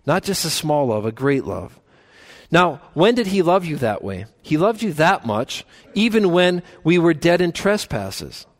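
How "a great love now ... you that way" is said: ordinary talk, nothing marked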